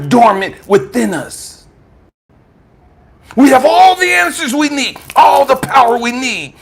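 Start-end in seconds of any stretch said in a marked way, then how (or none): 3.3-6.5 high, ringing proclamation; impassioned preaching. Real, not acted. Preacher tells listeners they hold all power, impassioned. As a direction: drive it out with preacher's force.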